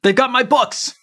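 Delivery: high-pitched yelling; angry shouting merchant. Retold or imitated. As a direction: point and shout at the thieves. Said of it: "He's saying, like".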